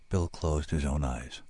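ordinary delivery

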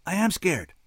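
clinging to his father; scared boy. A narrator's reading. Boy admits fear.

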